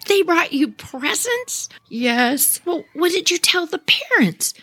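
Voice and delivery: high, disbelieving mother's questions; shocked mom. Retold or imitated. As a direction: ask it aghast, as if you can't believe it.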